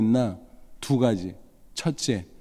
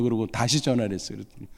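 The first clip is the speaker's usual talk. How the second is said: normal talking voice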